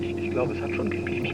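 soft, whispered uncertainty; hushed unsure. Acted. Old man baffled by answering machine, soft whisper.

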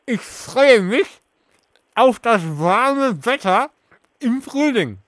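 talking through a mouthful of marshmallows; slow, stuffed-cheek speech; mouth full. Real, not acted. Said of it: "Ich lese dir gleich mit vollem Mund alle Sätze vor".

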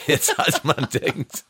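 laughing. Real, not acted. Laughs at his own wild life.

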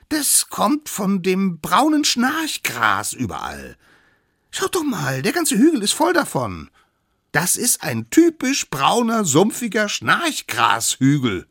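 put-on high, loud, exasperated child figure; stubborn little expert. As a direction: insist on the absurd theory. Youngest insists on new explanation. (kleiner Besserwisser, hoch, laut und beharrlich)

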